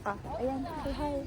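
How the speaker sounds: ordinary delivery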